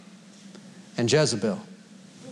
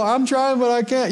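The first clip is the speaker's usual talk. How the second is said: mock helpless excuse; 4 semitones higher than usual. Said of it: "you don't go".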